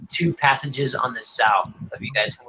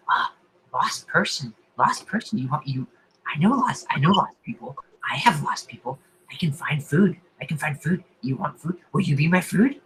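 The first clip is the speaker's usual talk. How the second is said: softer, half-whispered crazed voice; insane sporadic whisper; 4 dB quieter than usual. Acted. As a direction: murmur hungrily like a mad thing lost in the dark. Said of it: "a little insane, kind of sporadic".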